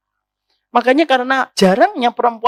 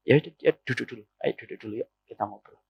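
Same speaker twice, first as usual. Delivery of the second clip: calm soft husband; 11 semitones lower, 10 dB quieter, faster than usual. Acted. Husband answers calmly.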